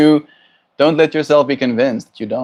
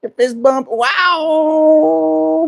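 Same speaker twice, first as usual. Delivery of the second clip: very high, gushing praise to the bird; excited pet praise; much slower than usual. Real, not acted. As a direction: squeal with delight at each trick.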